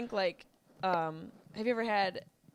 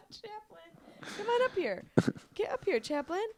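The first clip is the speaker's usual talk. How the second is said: calling a dog; 10 semitones higher than usual. Real, not acted.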